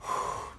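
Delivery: long, audible calming breath; demonstrated breath. Shown as a demonstration. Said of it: "Breathe in".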